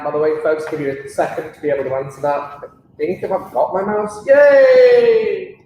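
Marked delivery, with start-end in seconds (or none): 4.3-5.6 high, loud little cheer of relief; excited yay. Real, not acted. Teacher finding her mouse mid-lesson, real excited cheer. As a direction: cheer as the lost item turns up. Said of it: "finds her mouse in bag".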